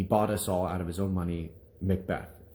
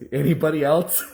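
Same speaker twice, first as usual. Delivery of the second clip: high, loud plea for another volunteer; desperate teacher; 7 semitones higher, 6 dB louder than usual. Retold or imitated. Teacher begging the class for another reader, desperate. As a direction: pitch up, urgent and pleading.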